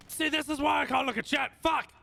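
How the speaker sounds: sudden high yelling outburst at the chat; real frustrated shout. Real, not acted. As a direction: blurt it out loud and high, annoyed. Streamer reacts to chat, shouting.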